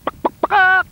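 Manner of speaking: high, loud chicken squawks; clucking chicken. Acted. Chicken taking the slide, loud squawking. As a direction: squawk like a startled hen.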